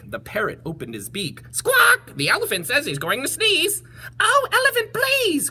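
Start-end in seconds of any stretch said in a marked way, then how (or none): 1.5-2.2 very high, loud squawk; piercing parrot squawk; parrot shriek. Acted. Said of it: "With a shriek". 2.2-3.8 high, louder parrot warning; high squawky bird warning. Acted. Parrot speaking. 4.2-5.5 very high, louder bird plea; high pleading birds; crying birds. Acted. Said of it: "cried the birds".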